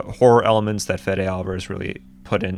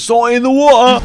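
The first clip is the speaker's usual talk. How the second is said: high, loud mock rough English accent on "Salt in the water"; 8 dB louder than usual. Imitating the character's accent to mock the dialogue, in an English accent. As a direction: squeal it in a hammed-up English accent. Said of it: "rough English accent".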